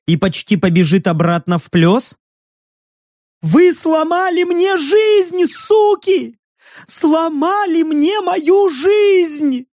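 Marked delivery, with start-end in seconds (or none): 3.4-9.7 высокий медленный надрывный крик; кричит как раненая птица; истеричный выкрик. Acted. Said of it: "Выкрик Нанны был похож на крик птицы". (пронзительный истеричный выкрик)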